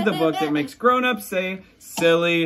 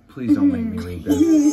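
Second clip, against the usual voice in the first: pleading; 10 semitones higher, 3 dB louder than usual. Acted. Reading the pleading book text.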